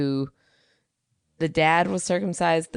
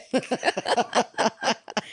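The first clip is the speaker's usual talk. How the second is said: unvoiced laugh; 6 semitones higher than usual. Real, not acted. Laughing at own joke.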